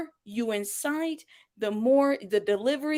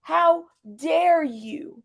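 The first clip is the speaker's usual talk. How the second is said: HOW DARE YOU stretched very slowly, raised in pitch; imitated indignation, "How... dare... you" hugely elongated; heavy emphasis; 5 semitones higher, much slower than usual. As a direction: draw out each word of "How dare you", high and deliberate. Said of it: "Because she's really emphasizing".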